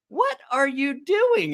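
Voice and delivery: high, slow, disbelieving; retold disbelief; incredulous. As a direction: stretch it out in mock shock. Incredulous reply to friend.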